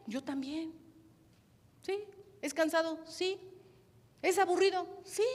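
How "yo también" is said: ordinary delivery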